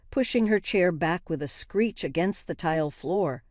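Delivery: ordinary delivery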